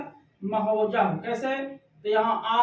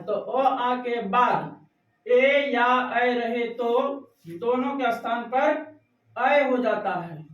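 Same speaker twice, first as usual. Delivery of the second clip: slow dictation; slower than usual. Shown as a demonstration. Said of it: "teacher dictating the rule".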